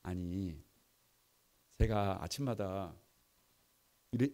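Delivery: usual speaking voice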